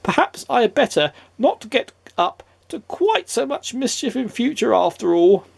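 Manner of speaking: high, lightly voiced rueful imp; a small rueful trickster, high; high cheeky imp. A narrator's reading. Mister Mischief to himself, high rueful imp voice.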